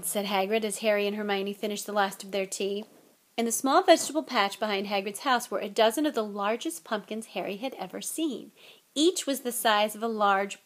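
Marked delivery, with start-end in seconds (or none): none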